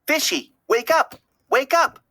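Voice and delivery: put-on high, rising child pleading to wake up; urgent little girl. A narrator's reading. Darla shaking the bag begging her fish to wake, urgent.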